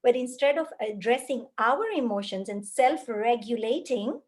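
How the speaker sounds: ordinary talk, nothing marked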